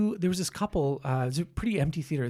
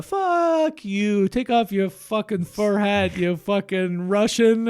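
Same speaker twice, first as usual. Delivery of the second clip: mock outburst; 4 semitones higher, 5 dB louder, slower than usual. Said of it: "I say".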